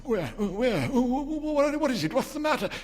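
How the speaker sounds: high, stammering alarm; startled awake, stumble over the words. A narrator's reading. Husband jolted awake, asking his wife in high alarm.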